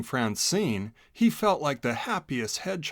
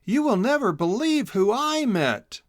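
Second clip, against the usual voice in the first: high, bright burst of news, voice jumping up; can't wait to share the secret; excited; 10 semitones higher, 4 dB louder than usual. A narrator's reading. Hedgehog Chisel bursting to tell his friend Boodle, excited. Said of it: "excitedly told her".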